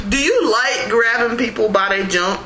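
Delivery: high, laughing taunt; laughing accusation. Real, not acted. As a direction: laugh while pressing the question.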